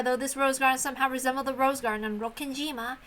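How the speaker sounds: plain reading voice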